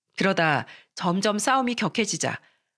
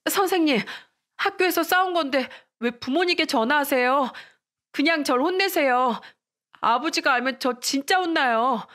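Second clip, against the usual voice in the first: high-pitched, anxious schoolgirl plea; alarmed pleading; 7 semitones higher than usual. Acted. Pupil begging teacher not to call her parents, alarmed. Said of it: "숙자는 놀란 목소리로 말했습니다".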